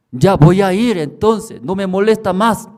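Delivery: high, loud whiny complaint; woman woken at night, high and loud; annoyed sleeper. Retold or imitated. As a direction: push the voice away, half asleep. Woman giving in.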